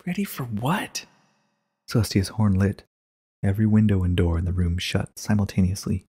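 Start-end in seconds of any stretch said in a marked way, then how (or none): 0.1-1.1 Twilight, wary. Acted.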